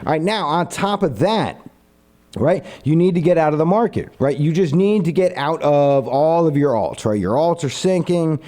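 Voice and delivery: ordinary talk, nothing marked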